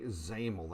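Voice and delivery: "xaml" with a Z sound, rhyming with camel; pronunciation of xaml. Shown as a demonstration. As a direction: say "xaml" as one word, ZAM-ul. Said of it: "that's how that's said".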